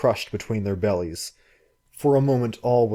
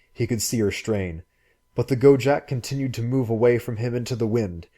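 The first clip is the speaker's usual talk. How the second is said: plain reading voice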